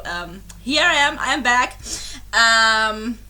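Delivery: usual speaking voice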